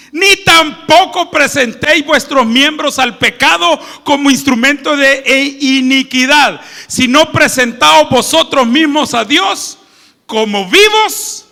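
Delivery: fervent preacher reading; preaching scripture. Acted. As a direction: proclaim the verse to the congregation. Reading scripture at full force.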